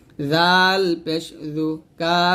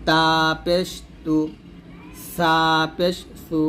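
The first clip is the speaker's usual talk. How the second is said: ordinary delivery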